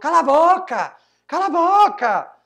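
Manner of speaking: high, very loud shout; imitated crazed yelling. Retelling the man who yelled at him, furious imitated shout. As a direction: yell it like a man losing his head. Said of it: "começou a gritar que nem louco".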